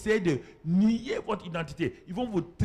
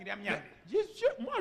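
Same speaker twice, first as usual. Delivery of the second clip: emphatic self-assertion; 3 semitones higher, 4 dB quieter, slower than usual. Acted. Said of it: "David asserting himself".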